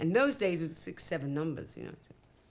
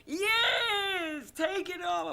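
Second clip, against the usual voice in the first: high, crying mock heckle, much higher; mocking heckler; 12 semitones higher, 4 dB louder, much slower than usual. Singer mimics the Glasgow crowd jeering, in a high crying wail. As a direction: ham up a jeering audience wail. Audience heckling her on stage.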